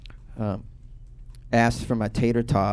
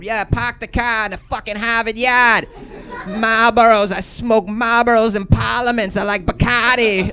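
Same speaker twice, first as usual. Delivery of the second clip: put-on loud, high Boston accent showing off; Boston accent, dropped Rs, raised pitch; thick Boston accent; 9 semitones higher, 4 dB louder than usual. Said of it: "just talk with a Boston accent".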